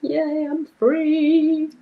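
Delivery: high, drawn-out mock celebration; long, high-pitched "yay" of freedom, acted out; retold cheer. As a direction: re-enact a relieved cheer, high and stretched out. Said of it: "and then be like".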